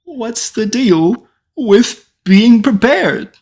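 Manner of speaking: Jerry Seinfeld Illidan. Retold or imitated. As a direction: do Illidan as a standup bit. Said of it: "Jerry Seinfeld voice Illidan".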